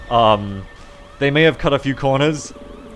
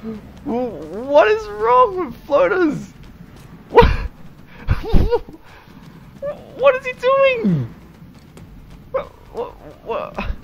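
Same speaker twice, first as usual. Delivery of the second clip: much higher, slow incredulous whats; baffled disbelief; 4 dB louder, slower than usual. Real, not acted. Gamer baffled by an alien's odd move, high disbelief. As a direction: squeal in disbelief at a soldier's blunder.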